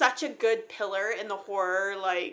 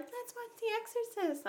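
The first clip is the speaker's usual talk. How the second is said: friends' voice; 10 dB quieter than usual. Retold or imitated. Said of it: "some of my friends were like".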